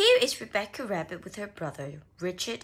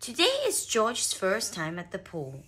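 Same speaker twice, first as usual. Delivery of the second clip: usual speaking voice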